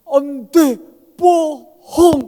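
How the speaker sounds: put-on high, loud childlike protest; childlike "you lied" protest; [name] accusing. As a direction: call him a liar like a disappointed child. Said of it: "Dia bilang apa?".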